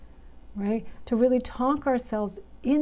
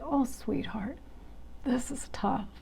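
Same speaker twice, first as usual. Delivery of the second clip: much softer, whispered comfort; tender whispered self-talk; soothing whisper; 8 dB quieter than usual. Shown as a demonstration. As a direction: breathe the words kindly. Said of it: "kind and caring and soothing".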